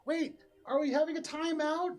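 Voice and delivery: put-on high-pitched, loud child's yell; kid yelling. Game master voices another kid at the party, yelling. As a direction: yell it like a confused kid at play. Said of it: "You hear another one kind of yell".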